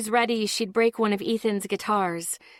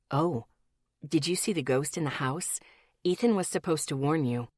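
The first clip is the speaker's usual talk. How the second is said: lower, soft male voice; man's voice; 5 semitones lower, 4 dB quieter than usual. A narrator's reading. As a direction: play the gentle friend, lower. Miles voiced by narrator.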